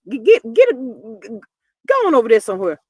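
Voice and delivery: loud, sharp put-down; Nancy Grace. Retold or imitated. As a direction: shoo him away like a stern host. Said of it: "and she just".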